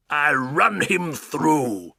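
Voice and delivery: slow, drawn-out blustering threat; Duke shouting. Acted. Duke brandishing his sword, slow blustering shout. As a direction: stretch out the old Duke's bluster. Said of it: "he shouted".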